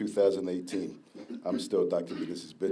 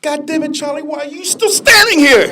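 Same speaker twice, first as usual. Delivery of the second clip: high, loud exasperated outburst; mock furious yell; 16 dB louder than usual. Sick mentor yelling at Charlie to hurry, mock furious shout. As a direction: blast him for still standing there.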